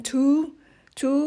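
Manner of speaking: "two" with its final v sound barely voiced; final sound dropped. Shown as a demonstration. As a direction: let the end of "two" fade into the vowel. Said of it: "เราจะไม่ค่อยออกตัวเวอร์ ตัวท้าย".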